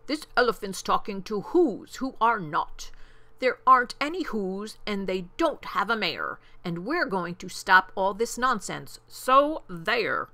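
loud, indignant monkey bullies; emphatic, mocking storybook shout; scornful shouting. A narrator's reading. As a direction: shout it down as rubbish, punching key words. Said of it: "Wickersham brothers came shouting".

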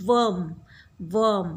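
"worm" with the vowel เออ; pronunciation demo. Shown as a demonstration. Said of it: "ออกเหมือนวอร์ม แต่ไม่วอร์ม worm นะคะ สระเออ". (ออกเสียง "worm" สระเออ ให้เด็กฟัง)

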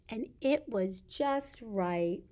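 usual speaking voice

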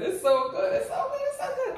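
high giggly delight; laughing. Real, not acted. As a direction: laugh through the words. Tasting food, laughing.

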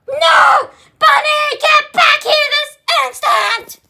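high, loud, commanding yell; furious shout. Acted. Captive puppy yelling at Bunny to come back, furious. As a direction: order her back like a scolding parent.

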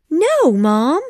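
put-on high-pitched little girl's cry; protesting young Wendy. A narrator's reading. Wendy objects to her mom's joke, high protesting cry. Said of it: "cried Wendy".

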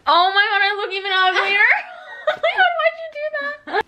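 high, loud teary wail; half laugh, half sob; crying laughter. Real, not acted. Despair at the mirror.

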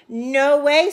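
loud brushing-off no; flat refusal. A narrator's reading. Brother refusing his sister, loud brush-off. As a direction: shut her down loudly. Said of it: "said Jose".

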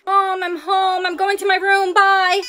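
loud, high call through the house. Acted. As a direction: shout it from the hallway. Calling out to mom, hiding baby.

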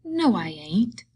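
earnest, drawn-out protest; earnest denial. A narrator's reading. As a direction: deny it earnestly, stretching the words. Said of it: "Lenny explained earnestly".

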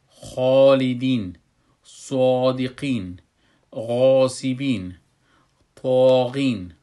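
loud, slow recitation with dark, rounded long vowels; drawn-out tajweed word list, heavy alif; full, heavy alif. Shown as a demonstration. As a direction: hold each alif thick after the heavy letter, as in "qoola".

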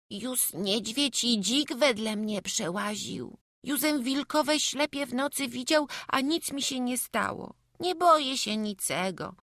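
put-on high-pitched child boasting of courage; plucky kid, high and insistent; brave little boy. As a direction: put on a small boy's high, lisping voice. Voices the boy in the story.

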